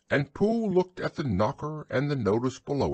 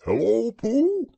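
high-pitched owl character voice; high, lilting storybook owl; Owl's greeting; 13 semitones higher, 6 dB louder than usual. A narrator's reading.

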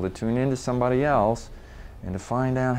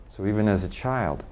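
usual speaking voice

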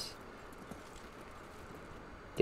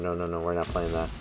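normal talking voice

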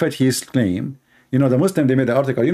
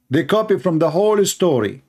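usual speaking voice

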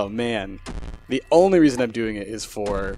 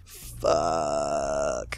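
low, soft, long drawn-out groaned swear; defeated curse; 9 semitones lower, 6 dB quieter than usual. Real, not acted. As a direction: sink into a despairing curse. Swearing over the loss, second take.

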